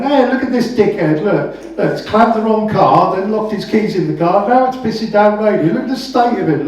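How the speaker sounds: mocking, jeering high voice at a stranger. Brother jeering at the clamper to passersby, mocking loud. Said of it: "he's going".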